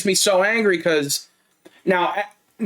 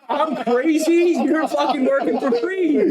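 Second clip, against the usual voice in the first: much higher, laughing through the words; laughing disbelief; 11 semitones higher, slower than usual. Retold or imitated. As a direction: laugh it out in disbelief, very slow at the start. Retells his reply to a coworker, laughing.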